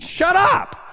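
very high, loud imitated scream; screamed command. Preacher voicing gunman silencing Alex, screamed. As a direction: explode in one furious shout.

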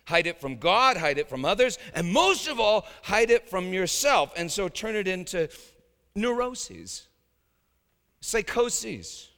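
loud, high fiery sermon; impassioned preaching. Real, not acted. Preacher exposing the devil's lies to his flock, fiery and loud. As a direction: rail against it at full force.

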